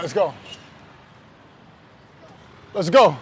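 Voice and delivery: ordinary talk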